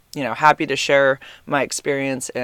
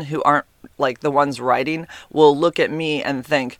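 ordinary delivery

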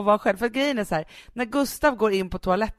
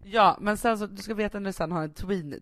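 ordinary talk, nothing marked

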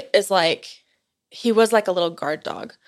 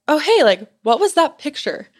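lightly raised, bright asking voice; curious casual question; 7 semitones higher, 5 dB louder than usual. Retold or imitated. Speaker asking her sister at a work lunch, curious. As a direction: toss the question out breezily.

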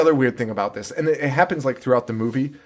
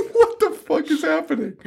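laughing disbelief; 14 semitones higher than usual. Real, not acted. Commentary laughing in high voice.

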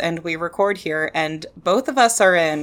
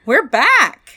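bright, loud excited cheer; 9 semitones higher, 5 dB louder than usual. Real, not acted. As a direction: announce it with a burst of joy. Excited return announcement.

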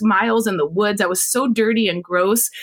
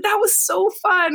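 gushing delight; 9 semitones higher than usual. Retold or imitated. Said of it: "I was like".

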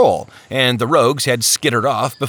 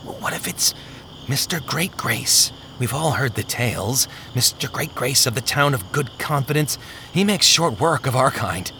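softer, breath-caught fearful whisper at first; frightened hush; 4 dB quieter than usual. Acted. Faintheart tells his brothers of Great Grace, hushed with fear. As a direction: afraid to even say the name. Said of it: "the words catching in his throat".

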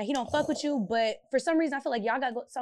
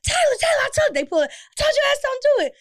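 much higher and louder, calling her name; officers shouting; 9 dB louder than usual. Retold or imitated. Police officer to Taylor during the fight, calling out loud. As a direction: yell her name to stop her.

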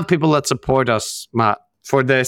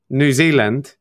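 usual speaking voice

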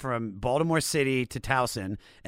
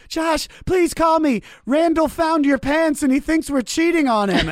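mock crying plea; 9 dB louder than usual. Rebecca's crying voicemail, imitated high and wailing. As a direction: ham up her tearful voicemail. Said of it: "she's, like, crying".

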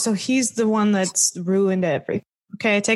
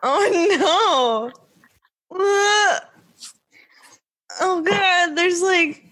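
horrified; 7 semitones higher, slower than usual. Real, not acted. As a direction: squeal in disgust at the picture. Reacting to creepy dummy photo.

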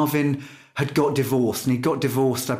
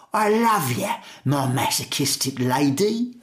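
[name] in Cockney. Retold or imitated. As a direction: croon it as a soul singer gone mock Cockney. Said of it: "but with [name] singing in a Cockney accent".

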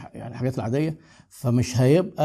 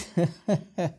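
laughing; 3 semitones higher, 4 dB quieter than usual. Real, not acted. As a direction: let out a brief real chuckle. Amused aside about his wife.

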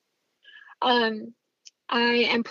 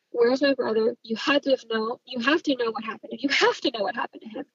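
ordinary delivery

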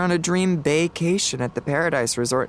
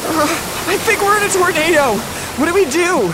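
high, loud, rushed panic over the wind; frantic yelling; panicked shouting; 12 semitones higher, 4 dB louder, faster than usual. Acted. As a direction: yell it in terror as the storm hits. Panic inside a tornado.

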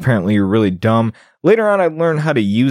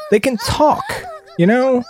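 laughing disbelief; 13 semitones higher than usual. Real, not acted. Laughing disbelief about goblins.

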